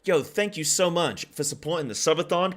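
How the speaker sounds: loud, high hyped thanks; subathon hype. Real, not acted. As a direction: pump up the chat. Hyping a subathon gift.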